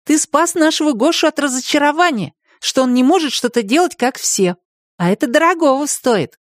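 put-on a girl praising her friend sincerely; lightly voiced young girl, fond; girl's voice. Katya voiced high.

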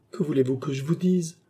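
high, plaintive pleading; stifled sob. A narrator's reading. Maid pressed for the truth, tearfully pleading. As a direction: plead as if choking back tears. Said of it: "en étouffant un sanglot".

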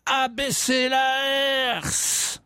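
high, drawn-out cry across a rampart; long sustained high holler; howled command. Retold or imitated. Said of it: "une voix qui hurle".

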